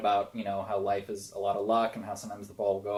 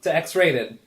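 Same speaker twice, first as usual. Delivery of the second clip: loud host title call; announcer voice; 5 semitones higher, 8 dB louder than usual. Acted. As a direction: announce the show name grandly. Show intro announcement.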